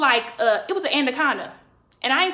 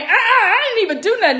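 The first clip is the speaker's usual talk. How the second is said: high, raised-voice denial; indignant protest; 8 semitones higher, 4 dB louder, faster than usual. Retold or imitated. Suspect still arguing while hands are held, indignant protest. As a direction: insist on innocence in mock outrage.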